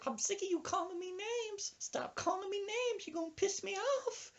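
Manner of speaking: put-on very high falsetto old-woman complaint; imitating a fed-up woman in falsetto; indignant woman. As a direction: squeal it in a high mock old-lady voice. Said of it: "then she stands up".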